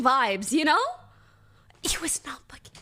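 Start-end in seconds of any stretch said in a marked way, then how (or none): 1.8-2.7 whisper. Real, not acted. Stressed aside about the guy.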